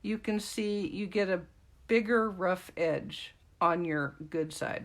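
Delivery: ordinary talk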